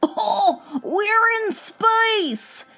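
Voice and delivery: high, drawn-out thrilled cry; elated yell, stretched out long; excited yell. Acted. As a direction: yell it in high-pitched wonder. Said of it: "Danny yelled as they floated around".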